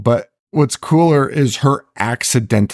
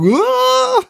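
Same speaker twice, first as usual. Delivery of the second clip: a sudden high yelp as the door hits him; high mock scream. Guy hit by a door on the roof, high mock scream. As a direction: squeal like a man knocked off a roof.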